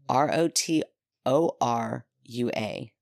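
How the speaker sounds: ordinary talk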